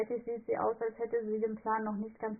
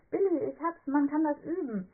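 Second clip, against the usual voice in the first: much higher and louder, bursting with the idea; eureka excitement; 7 semitones higher, 5 dB louder than usual. Acted. Fräulein Schmalzbrot tells Billy her sudden idea, excited and loud. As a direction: shout it out like it just hit you.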